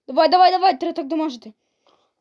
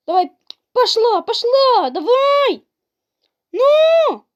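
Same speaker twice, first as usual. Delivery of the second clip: high, loud urging of the final hits; frantic yelling; 9 semitones higher, 5 dB louder, much slower than usual. Real, not acted. Gamer screaming as he finishes off the boss. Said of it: "Чо я кричу?".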